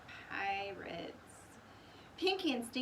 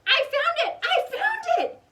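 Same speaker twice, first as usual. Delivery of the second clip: loud, much higher thrilled cry; excited cry; 13 dB louder than usual. A narrator's reading. Jack finds the treasure, loud thrilled cry. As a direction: burst out with joy. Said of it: "cried Jack".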